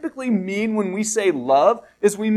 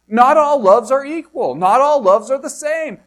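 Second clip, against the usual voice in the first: higher and louder, all hit hard; emphatic insistence; 5 semitones higher, 5 dB louder than usual. Real, not acted. Pastor pressing the point to the congregation, loud and emphatic. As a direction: drive home that not all loves match.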